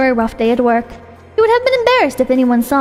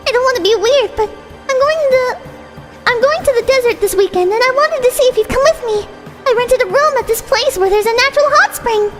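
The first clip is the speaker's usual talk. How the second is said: put-on high sweet girlish voice; bright, breathy young girl; high cute anime girl; 9 semitones higher than usual. Voiced female character.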